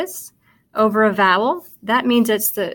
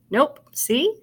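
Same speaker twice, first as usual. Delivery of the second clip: usual speaking voice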